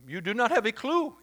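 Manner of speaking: hammed-up French accent like Clouseau. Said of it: "And they have this French accent".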